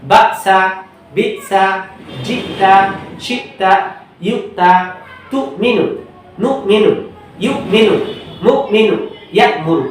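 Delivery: pronunciation demo for students; clear reading demo. Shown as a demonstration. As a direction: read each word clearly without spelling. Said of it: "পড়া নেওয়ার সময় ঠিক বানান ছাড়া উচ্চারণটা".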